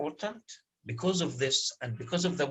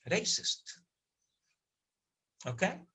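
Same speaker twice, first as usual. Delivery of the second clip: whispered emphasis; 4 semitones higher, 8 dB quieter than usual. Real, not acted. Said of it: "and pay attention".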